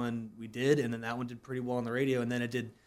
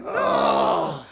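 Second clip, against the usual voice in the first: long, loud mock groan of "no"; drawn-out loud cry of defeat; dismayed groan; 10 semitones lower, 9 dB louder than usual. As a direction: groan in comic agony at being shortchanged. Retold dismay at losing pay.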